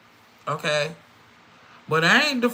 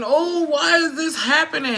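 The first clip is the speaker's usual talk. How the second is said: high, whiny mock lament, louder; a mock sob of why me; mock crying whine; 9 semitones higher, 4 dB louder than usual. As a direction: imitate a heartbroken wail, hammed up. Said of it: "we be crying and saying".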